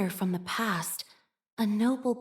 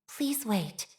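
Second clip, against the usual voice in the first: ordinary talk, nothing marked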